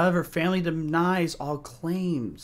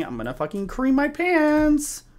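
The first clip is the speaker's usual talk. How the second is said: much higher, jittery; nervous high-pitched exclaim; 10 semitones higher than usual. Real, not acted. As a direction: squeak it out nervously. Nervous joke in horror game.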